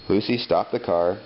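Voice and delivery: plain reading voice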